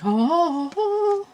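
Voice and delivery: high, rising squeals of delight; excited squeals. Acted. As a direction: squeal like a giddy fan. Excited page reacting to song.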